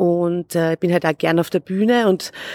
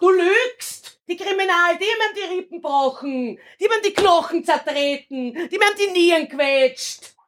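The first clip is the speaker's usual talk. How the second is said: high, furious stage shouting; furious accusation; 11 semitones higher than usual. Acted. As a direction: hurl the accusation at her. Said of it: "Du hast da voll alles gegeben".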